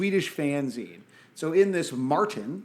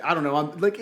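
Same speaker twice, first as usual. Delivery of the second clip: normal talking voice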